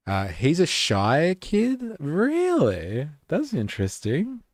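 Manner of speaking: high, slow, exaggerated surprise; mock surprise. Real, not acted. Host reacts to learning the speaker is from the Shire, mock amazed.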